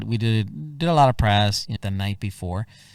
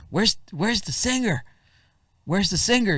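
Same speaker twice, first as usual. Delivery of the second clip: anxious dude; 10 semitones higher than usual. Retold or imitated. Dude asks for the singer.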